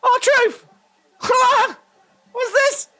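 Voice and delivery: high, excited exclamations; excited outburst. Real, not acted. Viewer greets R-Truth's arrival, high and excited. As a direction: burst out high at a surprise arrival. Excited reaction to wrestler's entrance.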